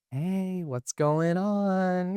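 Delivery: high, slow, sheepish sing-song greeting; guilty kid voice. Retold or imitated. Friend imitates tripping Colton's sheepish greeting, high and slow. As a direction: mimic a kid caught after breaking something. You look like a kid that just broke something.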